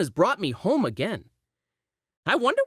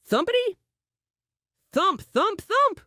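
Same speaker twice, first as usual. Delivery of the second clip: pounding heart sound; 12 semitones higher than usual. A narrator's reading. Heartbeat sound effect in story.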